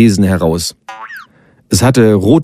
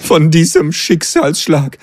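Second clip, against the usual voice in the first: higher, theatrical lament; dramatic little creature; 8 semitones higher than usual. A narrator's reading. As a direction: mourn it like a stage tragedy. Voices the Klugscheißerchen.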